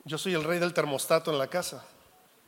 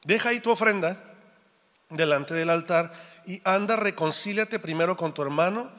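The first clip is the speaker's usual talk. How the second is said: louder, measured Bible reading; a pastor reading Matthew aloud to the church, solemn and louder; scripture reading; 5 dB louder than usual. Acted. Reads Mateo 5 aloud.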